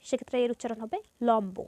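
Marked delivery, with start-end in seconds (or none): 1.3-1.6 লম্ব said "lom-bo", b sounded; b kept. Shown as a demonstration. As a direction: sound the ব clearly after m in লম্ব. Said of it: "এর উচ্চারণ বজায় থাকবে".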